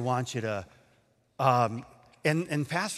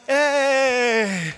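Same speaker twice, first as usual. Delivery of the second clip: drawn-out, high, loud cheer; acted cheer; 8 semitones higher, 6 dB louder than usual. Storyteller joining the crowd's celebration, acted cheer. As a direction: join in with a long hooray.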